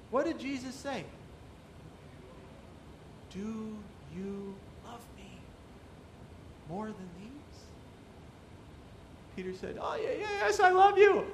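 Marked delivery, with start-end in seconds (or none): 3.3-7.6 soft, gentle asking; Jesus gently asking Peter, soft; tender question. Retold or imitated. 9.8-11.3 much higher, eager yes; Peter's high, eager reply; eager protest. Retold or imitated. Peter eagerly answering Jesus.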